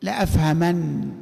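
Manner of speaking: slow, low لأفهمن closing on ن; light nun. Shown as a demonstration. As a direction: end لأفهمن on one silent ن, unhurried. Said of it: "نون التوكيد الخفيفة". (لأفهمن بنون خفيفة ساكنة)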